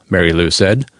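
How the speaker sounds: ordinary delivery, nothing marked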